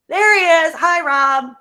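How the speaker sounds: bursting out happily at seeing Rob; real delight at seeing a friend on screen; excited. Real, not acted. Spots Rob on screen, relieved.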